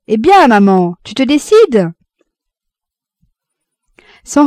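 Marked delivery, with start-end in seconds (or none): 0.1-1.9 loud, higher-pitched hail; cheerful shouted question to mother; calling out. A narrator's reading. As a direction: call across to someone at a distance. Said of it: "Jean lui cria".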